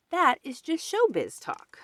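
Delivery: high, loud know-it-all tone; know-it-all scoff. Acted. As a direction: brush off the silly question. Mole scoffs.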